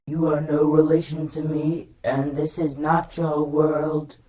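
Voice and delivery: lightly voiced stern robot; Soundwave impression. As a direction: sound cold and mechanical. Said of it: "And Soundwave said".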